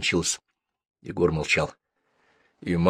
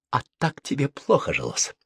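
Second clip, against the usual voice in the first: mocking; 7 semitones higher than usual. A narrator's reading. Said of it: "издевательским тоном спросил Максим".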